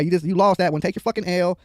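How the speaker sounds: ordinary talk, nothing marked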